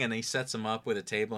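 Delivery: ordinary delivery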